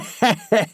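high, crackly wheezing laugh; malicious cackle. A narrator's reading. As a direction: cackle with spite. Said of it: "malignant, crackly laugh".

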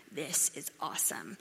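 hushed, breathless whisper of delight; awed whisper. Retold or imitated. Preacher voicing someone in love with a hobby, hushed whisper. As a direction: whisper it like a private thrill.

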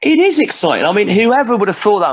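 genuinely thrilled, voice jumping up; excited. Real, not acted. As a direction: gush with real thrill. Thrilled about showbiz career.

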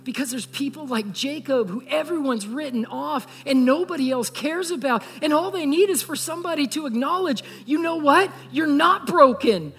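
preaching climax. Acted. Pastor urges the congregation to go out, preaching at full force. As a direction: lift the room with a closing appeal.

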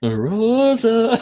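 Rosa impression. Calling out Rosa's name, high.

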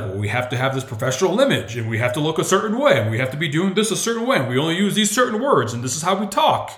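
hammed-up corporate drone; mock corporate voice. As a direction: parody the stiff company line. Mocking stiff corporate mindset.

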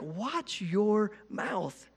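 slow, soft warning under the breath; stern warning, held back. Retold or imitated. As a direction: scold the kids quietly, dragging it out. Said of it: "I just wanna be like".

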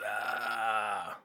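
long, low drawn-out groan; mock weary "ahh". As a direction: imitate grandma's resigned groan, low and soft. Acts grandmother's weary answer.

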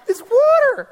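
high laughing disbelief. Real, not acted. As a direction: burst out laughing at how simple it is.

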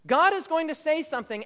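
ordinary talk, nothing marked

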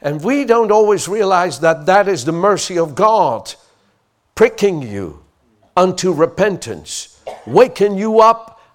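from fervent to shouting preacher; fiery preaching. Acted. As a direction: build the sermon to full force. Preaching builds to full force.

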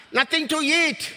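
ordinary talk, nothing marked

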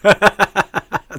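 loud burst of startled laughter. Real, not acted. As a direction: crack up loudly. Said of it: "that just threw me off".

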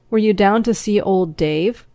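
ordinary delivery, nothing marked

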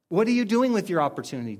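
ordinary delivery

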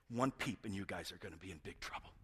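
low, whispered warning; hushed threat. Retold or imitated. Father warning kids put to bed, low and hushed. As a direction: drop low and quiet to warn the children.

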